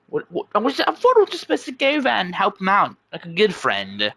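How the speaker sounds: stammering, high-pitched protest; high, flustered self-defence. Acted. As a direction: stumble in, climb high, drop at the end. Jax protests when scolded by Sparrow.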